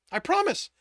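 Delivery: high, desperate promise. Acted. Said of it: "The desperation in Tristan's voice".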